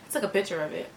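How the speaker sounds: ordinary talk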